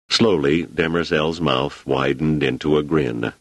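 usual speaking voice

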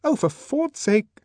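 high, loud, slow exasperation; voiced Lenina, fed up and pitched high; exasperated. A narrator's reading. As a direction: snap after long silence. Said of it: "breaking her stubborn silence".

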